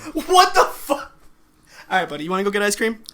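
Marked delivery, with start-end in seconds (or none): 0.0-1.1 high, slow, drawn-out laughing disbelief; laughing shock. Real, not acted. Cohost reacts to mocking a kid, laughing shock.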